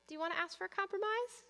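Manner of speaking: put-on high, sing-song prompt to a whiny child; gentle reminder. Shown as a demonstration. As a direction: lift the voice into a light, coaxing reminder. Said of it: "give them the little reminder".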